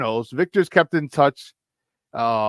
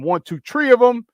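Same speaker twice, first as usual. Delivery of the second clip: put-on Chicago accent; 10 semitones higher, 5 dB louder than usual. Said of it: "In my Chicago accent".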